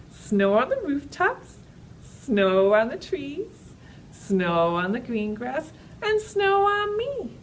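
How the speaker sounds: slow singsong rhyme for toddlers; drawn out, playful storytime chant; slow action rhyme. Acted.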